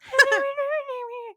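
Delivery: very high, loud squeaky chipmunk chatter; chipmunk squeak. Retold or imitated. Mimicking an actor's high natural range for the co-host, chipmunk squeak. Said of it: "he sounds like a chipmunk".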